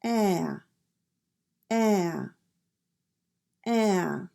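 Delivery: the flat æ vowel, mouth open; "ah" said as a flat æ, like in cat; ugly American A. Shown as a demonstration. Teacher drilling the American A for learners, flat and clear. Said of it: "This sound can be difficult to make".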